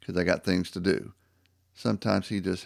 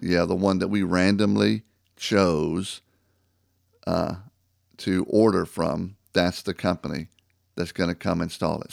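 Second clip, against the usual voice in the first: ordinary delivery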